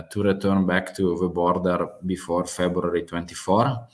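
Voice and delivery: usual speaking voice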